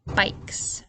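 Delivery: Bikes whispered; whispered word. Shown as a demonstration. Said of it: "Listen to those vowel sounds".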